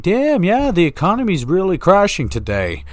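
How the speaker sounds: high, loud smug voice; pompous know-it-all. Pretend. Self-important small talk.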